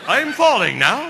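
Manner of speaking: high, loud, slow mock warning; mock yell. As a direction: yell it out as an absurdly orderly announcement. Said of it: "alert your partner by yelling".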